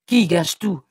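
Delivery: loud, indignant retort; vociferating. A narrator's reading. Son retorts to his mother, vociferating. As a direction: fire the question back at full volume. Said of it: "vociféra-t-il".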